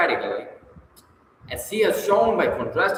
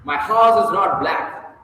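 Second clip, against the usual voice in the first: "not" said slowly and louder; stress on not; 5 semitones higher, 5 dB louder, slower than usual. Shown as a demonstration. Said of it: "If you say".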